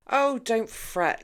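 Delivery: a slow theatrical declaration; declaring. A narrator's reading. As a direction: pronounce it like a grand host. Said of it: "Liam declared".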